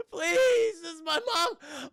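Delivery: high, hammed-up tearful begging; overacted wailing captive; mock desperate plea. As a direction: plead loudly in a parody of terror. Acts the captive pleading for release.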